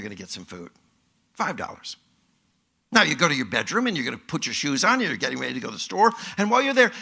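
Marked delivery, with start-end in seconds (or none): none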